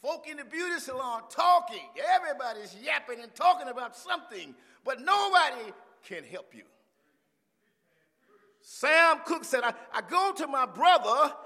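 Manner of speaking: high, loud, emphatic sermon; full-force preaching. Real, not acted. Preacher quoting a singer on helpless kin, fired up. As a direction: belt it out to the congregation.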